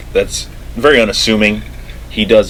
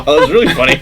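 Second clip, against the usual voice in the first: high, laughing words; 7 semitones higher, 3 dB louder, faster than usual. Real, not acted. Cracking up at the marker mix-up, laughing. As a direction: talk through the laughter.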